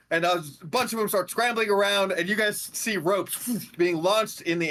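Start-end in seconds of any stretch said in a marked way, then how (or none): none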